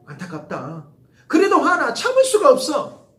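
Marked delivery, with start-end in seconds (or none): 1.3-3.1 loud, high burst of frustration; hammed-up fury; mock anger. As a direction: explode as if you can't hold it in. Acting out anger.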